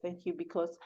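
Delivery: soft, earnest spoken prayer; hushed, even prayer of thanks; closing prayer. Real, not acted.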